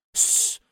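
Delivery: sharp loud shushing hiss. A narrator's reading. Lorenzo silencing the others to listen, loud shush. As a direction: hush everyone at once. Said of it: "dice Lorenzo".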